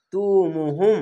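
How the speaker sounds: "তুমুহুম" with a long held মু; one-alif stretch. Shown as a demonstration. Teacher repeating the word for learners, "তুমুহুম" drawn out on মু. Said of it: "এক আলিফ টেনে পড়বেন".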